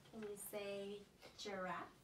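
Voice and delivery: put-on slow, soft prompt for the child. Shown as a demonstration. Therapist gently prompts the child, slowly. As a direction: ask slowly and gently for "giraffe". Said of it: "I'm gonna say a word".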